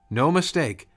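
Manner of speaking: ordinary delivery, nothing marked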